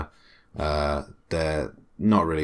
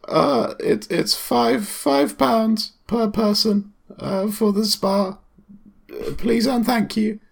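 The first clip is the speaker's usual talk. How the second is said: put-on much higher, hesitant teenage clerk; gym clerk voice; slower than usual. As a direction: squeak out as the nervous acne-ridden clerk.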